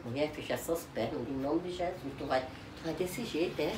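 low, very soft scolding; hushed reproach. Real, not acted. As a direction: scold under your voice. Ordem discreta à filha. (repreensão em voz baixa)

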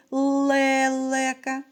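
"лелека" with the first е held long; second sound stressed. Shown as a demonstration. Teacher asking children for the next sound, drawing out the first е of "лелека". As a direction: stretch the first vowel е in "лелека". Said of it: "Наступний звук у слові".

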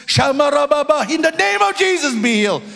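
high, loud tongues prayer, retold; praying in tongues loudly. Pastor prays over him in the restaurant, high and loud. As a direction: belt it out like a pastor laying on hands. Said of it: "praying in tongues at the top of his voice".

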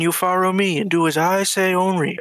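ordinary talk, nothing marked

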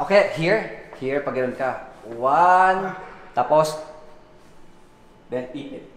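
usual speaking voice

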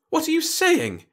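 high, breathless disbelief; shocked gasp. A narrator's reading. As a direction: gasp it out in horror. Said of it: "he gasped".

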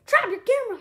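loud, high warning yell. A narrator's reading. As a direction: shout out an urgent warning. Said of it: "I yelled".

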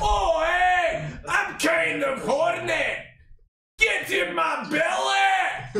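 a greedy Scottish giant calling out; mock Scottish accent. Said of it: "do a little bit of Scottish".

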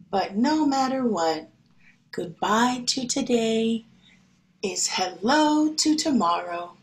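gentle picture-book reading voice; storybook reading. Acted. Teacher reading a picture book to her class, gentle.

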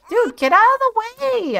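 high, loud frustrated shout; exasperated yell. Real, not acted. Player yelling at a sim in the way, frustrated shout. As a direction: yell at the sim blocking you.

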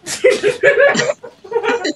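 loud squealing cackle; high shrieking laugh. Real, not acted. Gamer cracking up at her own joke with a high squeal.

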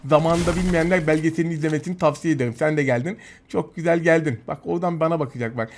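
usual speaking voice

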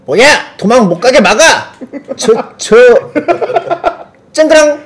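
high shouts broken by laughter; laughing while acting. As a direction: act the panel out while cracking up. Reading comic panel, laughing.

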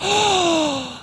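high, sharp gasp of surprise; delighted gasp. Real, not acted. Player suddenly sees Bendy, delighted gasp. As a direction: gasp as if spotting something adorable.

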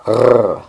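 throaty gargling R sound; gargled French R. Shown as a demonstration. Teacher explaining the French R, gargled throat sound. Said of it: "movimiento similar al de hacer gárgaras".

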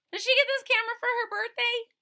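high, loud, fast; dramatic question. Acted. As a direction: ask with mock shock.

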